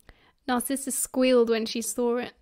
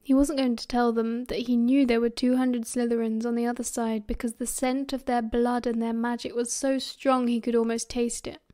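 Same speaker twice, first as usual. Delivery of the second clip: ordinary delivery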